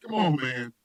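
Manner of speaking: normal talking voice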